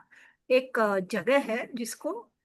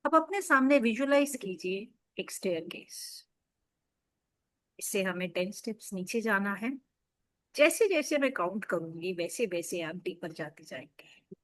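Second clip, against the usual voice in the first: softer, lower, soothing meditation lead; guided meditation; 3 semitones lower, 4 dB quieter than usual. Acted. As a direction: gently guide listeners into deep relaxation, leaving space between phrases.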